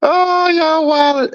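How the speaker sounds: high, slow, laughing squeal; high laughing disbelief; laughing falsetto. Real, not acted. Laughing at chat comments.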